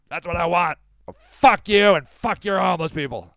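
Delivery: hammed-up angry rant, building to a loud curse; mock-furious put-on voice; angry Dan. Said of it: "why are you so angry?".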